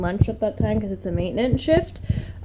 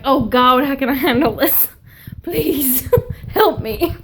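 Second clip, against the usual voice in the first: hammed-up overwhelmed plea; mock panic; 3 semitones higher, 3 dB louder than usual. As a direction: whine it like you're drowning in info. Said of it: "You're like".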